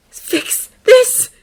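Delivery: high, loud, outraged echo; incredulous outburst. A narrator's reading. Draco rounding on Harry, incredulous angry outburst. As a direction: throw it back in disbelief. Wounded friend rounds on the other.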